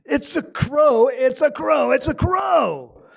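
exasperated son shouting, loud and high; hot under the collar. Retold or imitated. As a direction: act out a son blowing up at his dad, stretching the repeats. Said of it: "a little bit more hot under the collar".

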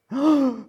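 loud, high mock gasp; shocked gasp. Onlookers gasp as the father runs, shocked.

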